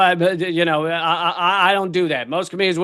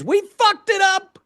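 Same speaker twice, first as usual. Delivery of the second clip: high, drawn-out wail of frustration; exasperated outcry; 11 semitones higher than usual. Real, not acted. Comic lamenting the wrecked planet, exasperated outcry. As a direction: wail it slowly at the peak of the rant. Climax of rant about humans.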